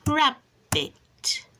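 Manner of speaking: rab, bit as two short beats with a gap; syllable by syllable. Shown as a demonstration. Showing two syllables to a child, syllable by syllable. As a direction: break rabbit clearly in two. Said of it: "two syllables".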